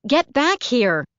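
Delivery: high, loud, slow command; calling out. A narrator's reading. Caroline shouting after fleeing Flick, loud command. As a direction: yell him back from the road.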